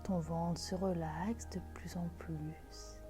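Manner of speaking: slow, soft meditation voice; guided meditation. Acted. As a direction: guide them into calm, gently. Leads a guided visualisation.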